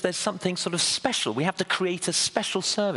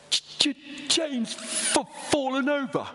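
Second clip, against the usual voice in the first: much higher, stammering punchline; stutter; 10 semitones higher, slower than usual. Retold or imitated. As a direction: stutter out the news that Jane fell, amused. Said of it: "I've got a stutter".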